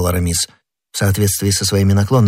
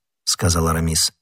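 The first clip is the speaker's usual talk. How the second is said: usual speaking voice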